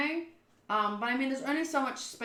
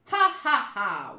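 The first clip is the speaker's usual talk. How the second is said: slow, spelled-out "ha ha ha"; deliberate staged laughing; acted laugh; 4 dB louder, slower than usual. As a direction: fake laugh in separate beats.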